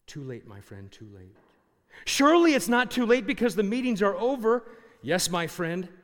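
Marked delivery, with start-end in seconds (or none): 0.1-1.4 low and soft, half to himself; preacher at the tent pegs, quiet and lower; soft muttered reply. Retold or imitated. Preacher mutters while working pegs. 2.1-4.6 higher and louder, urgent disbelief; young man protests to the preacher, loud and high. Retold or imitated.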